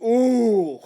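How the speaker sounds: an excited little ooh at a find; thrilled coo at the screen. Retold or imitated. Excited at seeing hoagie hat.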